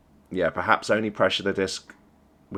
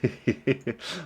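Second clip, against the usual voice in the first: light, higher giggle; real laughing; 4 semitones higher, 4 dB quieter than usual. Real, not acted. Coach listening to the team's comms, real laughing. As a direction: giggle to yourself in a quick run. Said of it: "Sorry, I'm just laughing".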